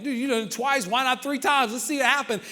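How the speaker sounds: high-pitched crowd excitement; eager egging on. Acted. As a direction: egg him on, voice lifted. Excited crowd eggs him on.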